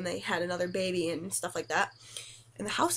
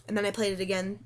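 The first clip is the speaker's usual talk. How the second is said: ordinary talk, nothing marked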